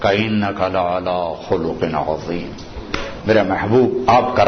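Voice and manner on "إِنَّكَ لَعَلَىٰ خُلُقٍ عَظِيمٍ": low, soft measured recitation of the verse; Quranic recitation. Acted. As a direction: intone the verse low and reverent.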